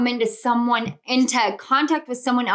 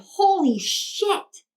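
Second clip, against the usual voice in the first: amazed; 6 semitones higher, much slower than usual. Real, not acted. Amazed by the reading.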